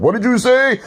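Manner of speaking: high, mock-baffled question; imitating a confused listener; mock incredulous. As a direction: squeak it out in disbelief. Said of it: "People are like".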